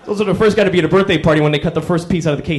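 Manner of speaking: usual speaking voice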